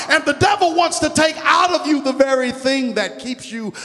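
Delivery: loud, high, shouted preaching; a preacher lifting the room; full-force preaching. Real, not acted. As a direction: preach it hard to the congregation.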